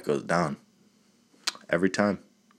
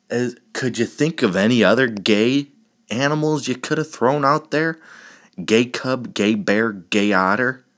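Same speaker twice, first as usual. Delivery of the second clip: exasperated rant; 4 semitones higher, 4 dB louder than usual. Real, not acted. Exasperated ranting at poster.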